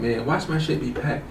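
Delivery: low, very soft aside; muttered prediction. Retold or imitated. Man expecting his things packed, low muttered self-talk. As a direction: act out grumbling to yourself under your breath.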